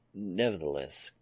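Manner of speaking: usual speaking voice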